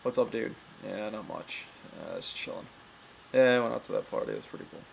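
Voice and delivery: low-key dude, phrases dropping at the ends; laid-back male voice, falling endings; men's talk demo. Shown as a demonstration. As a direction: talk like a chilling guy, ending on down notes. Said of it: "Men talk like".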